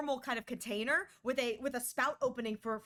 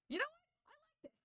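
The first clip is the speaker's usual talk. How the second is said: pleased surprise; 7 dB quieter, faster than usual. Retold or imitated. Tasting the smoothie.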